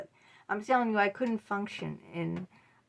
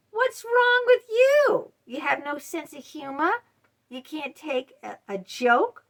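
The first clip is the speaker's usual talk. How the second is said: mocking narcissist; 6 semitones higher, 5 dB louder than usual. Narcissist dismissing your hurt after a dig, high sneering mock. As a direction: drag out the scorn like a bully. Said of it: "And then they say".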